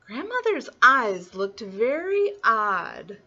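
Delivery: ordinary delivery, nothing marked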